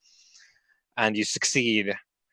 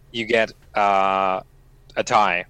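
ordinary talk, nothing marked

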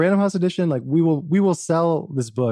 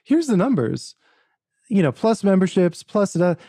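animated retelling; 7 semitones higher than usual. Retold or imitated. Retells confident refusal.

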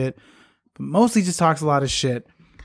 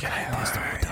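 whispering; 5 semitones lower, 10 dB quieter than usual. Pretend. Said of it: "Why are we whispering?".

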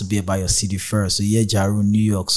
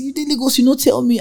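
high-pitched scolding aunt voice; aunt's high scolding reply; 14 semitones higher than usual. Retold or imitated. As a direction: play the aunt, shrill with surprise.

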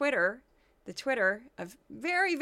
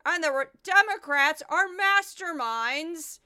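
loud, higher, furious ranting; outraged yelling impression; 4 semitones higher, 6 dB louder than usual. Mocking Stephen Miller ranting on Fox, shouting. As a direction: rant like he's outraged all the time. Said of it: "He's like".

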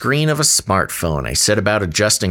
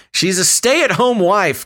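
mock earnest; 9 semitones higher than usual. Real, not acted. Teasing punchline.

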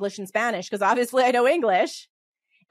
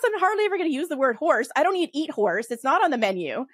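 ordinary talk

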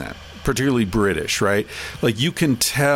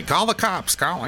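higher, lightly parroted squawk of words; talking-bird imitation; 7 semitones higher, 4 dB quieter than usual. Guest describing birds that mimic people, lightly imitated bird talk. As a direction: mimic a pet bird repeating phrases.

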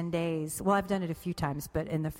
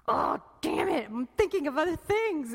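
mock frustration; 9 semitones higher than usual. Voicing frustrated meditator.

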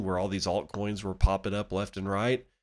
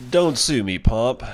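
loud joking plea; mock plea; 3 semitones higher, 7 dB louder than usual. Real, not acted. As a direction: call it out playfully.

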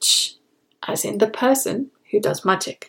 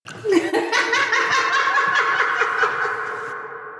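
high laugh. Real, not acted. Laughs at own wordplay.

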